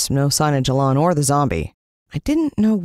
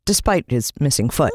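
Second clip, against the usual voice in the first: ordinary delivery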